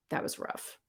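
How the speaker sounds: breathy whisper, no voice. Real, not acted. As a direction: whisper it to yourself. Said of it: "whew, that was rough".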